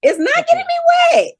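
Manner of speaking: loud, high-pitched exclamation; emphatic outburst. Real, not acted. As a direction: belt it out in exasperated protest. Emphatic repeat of her complaint.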